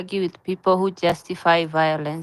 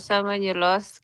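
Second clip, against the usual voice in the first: ordinary talk, nothing marked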